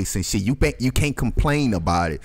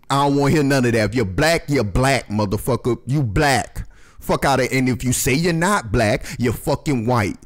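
ordinary delivery

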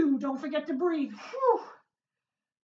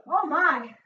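ordinary talk, nothing marked

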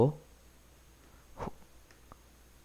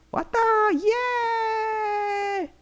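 excited shout; much slower than usual. Real, not acted. Treasure found, cheering.

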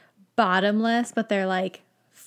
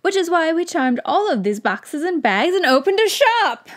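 higher and louder, passionate shopkeeper; passionate wizard; 8 semitones higher, 6 dB louder than usual. Acted. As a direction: sell the shop with passion. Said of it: "He's very passionate about that".

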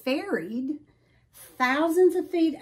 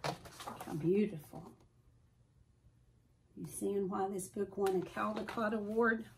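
soft aside; 2 semitones lower, 10 dB quieter than usual. Real, not acted.

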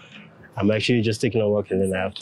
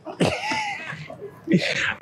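sudden burst of high-pitched laughter; high squealing laugh; 4 dB quieter than usual. Real, not acted. Reacts to her switch-off joke.

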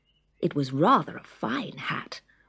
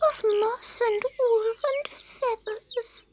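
tearful. Acted.

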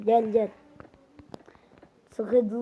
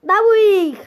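loud yelling; shouting; 8 semitones higher, 9 dB louder than usual. Pretend. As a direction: yell it out at full voice. Shouting at the chicken, threatening it.

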